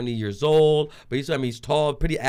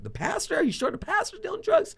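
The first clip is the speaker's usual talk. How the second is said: incredulous. Retold or imitated. Incredulous retort in story.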